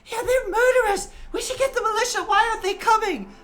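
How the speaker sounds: very high, loud accusing shout. Acted. Angry crowd member calling for the militia, high loud shout. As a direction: yell it from the crowd, high and furious. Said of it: "kind of shouting out".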